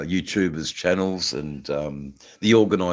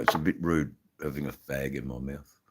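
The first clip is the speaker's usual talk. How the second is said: ordinary talk, nothing marked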